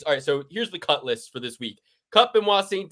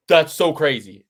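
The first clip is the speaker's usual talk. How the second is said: louder catchphrase with a touch of mimicry; DC impression; 6 dB louder than usual. Podcaster quoting a commentator's catchphrase to his co-host, light impression. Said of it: "As D C would say".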